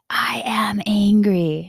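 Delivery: slow hushed whisper; whispered I am angry. Retold or imitated. The inner voice in the moment, slow whisper. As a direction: breathe the words slowly, almost unvoiced.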